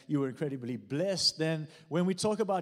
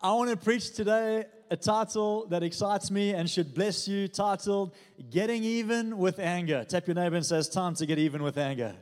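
preaching at full force; 3 semitones higher, 4 dB louder than usual. Real, not acted. Pastor announcing the sermon title loudly and rousingly. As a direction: proclaim the title to stir them.

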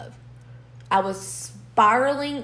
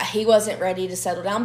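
usual speaking voice

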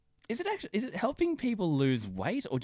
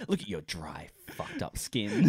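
humiliating sneer; 4 semitones lower, slower than usual. Retold or imitated. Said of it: "water drinking humiliation".

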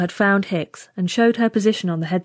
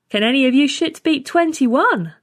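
raised, higher voice to a crowd; loud challenge; 6 semitones higher, 3 dB louder than usual. A narrator's reading. Trainee challenging the others in the mess, loud. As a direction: throw a dare out to the whole room. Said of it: "said in a loud voice".

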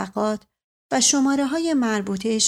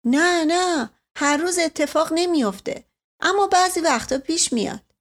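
lightly voiced man, reassuring; 7 semitones higher than usual. Man answers girl, higher voice.

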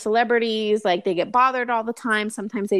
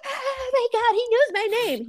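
starstruck gushing; 12 semitones higher than usual. Retold or imitated. Starstruck inner voice.

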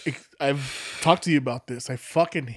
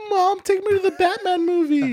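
put-on whiny kid voice. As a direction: beg like a little kid. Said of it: "I was like".

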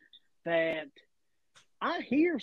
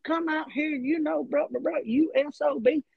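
retold yelling; 7 semitones higher than usual. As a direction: imitate him hollering from the parking lot. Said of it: "yelling obscenities".

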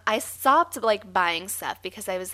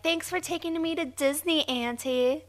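put-on child voice; 8 semitones higher than usual. Voicing the baby grown up.

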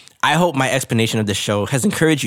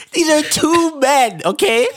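laughing; 12 semitones higher than usual. Real, not acted. Incredulous aside while laughing.

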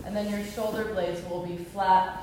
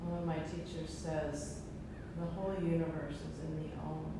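soft, calm breathing guidance; guided meditation; 2 semitones lower, 7 dB quieter than usual. Acted. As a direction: ease them into stillness. Closing meditation after om chanting.

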